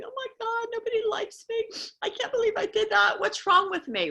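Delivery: hammed-up sad voice beating yourself up; mock self-pitying wail. Someone down on themselves, whining in a high mock voice. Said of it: "the emotions go low and sad and depressed".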